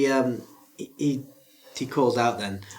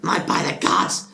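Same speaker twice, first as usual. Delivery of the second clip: panicked father; 2 semitones higher than usual. Acted. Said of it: "you hear him say".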